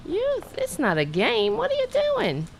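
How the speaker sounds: very high, soft playful chiding; pet-talk scolding. Real, not acted. Host telling the cat the hair roller isn't a game, playful high scolding. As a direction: chide a pet in a high little voice.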